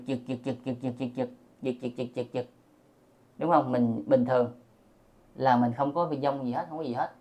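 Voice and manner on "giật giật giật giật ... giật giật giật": quiet, rapid-fire staccato repeats; mimicking twitches. Shown as a demonstration. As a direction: tick the word out like a twitching eyelid. (giả tiếng mí mắt giật liên hồi)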